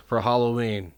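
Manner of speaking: normal talking voice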